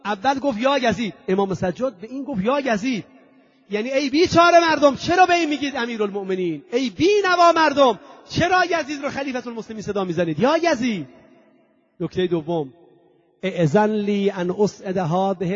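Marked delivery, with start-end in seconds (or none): 3.7-11.1 higher, louder fiery rebuke to the crowd; impassioned pulpit cry; preaching at full force. Acted. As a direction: preach it hard, pleading with the people.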